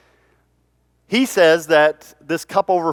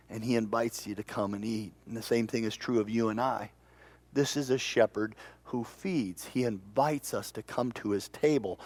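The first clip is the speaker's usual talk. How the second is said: ordinary talk, nothing marked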